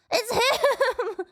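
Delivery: high, laughing cry of delight; laughing excitement. Real, not acted. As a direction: laugh it out in glee. Recognition, laughing.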